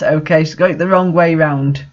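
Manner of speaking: ordinary talk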